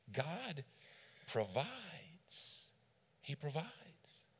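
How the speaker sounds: a hushed conviction; soft and slow. Real, not acted. Reflective quiet emphasis.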